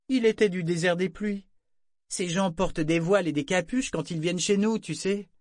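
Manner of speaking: high, pert, ironic explanation; ton ironique. A narrator's reading. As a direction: add it mockingly, as if obvious. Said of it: "elle prit un ton ironique". (ironie de jeune fille, voix aiguë)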